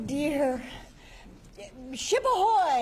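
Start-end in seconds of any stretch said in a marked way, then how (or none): 2.0-2.8 high, rising-out ship's hail; sailor's hail. Acted. Answer called out as a sailor's hail.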